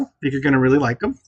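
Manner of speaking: ordinary delivery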